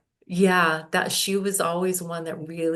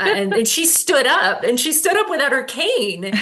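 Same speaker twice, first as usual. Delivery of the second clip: much higher and louder, thrilled; excited retelling; 8 semitones higher, 7 dB louder than usual. Real, not acted. Telling the host how the client walked without her cane, thrilled and loud. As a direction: gush about her standing up, voice soaring.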